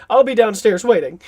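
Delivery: lightly hammed-up whiny sulk; John. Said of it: "The way he looks is whiny".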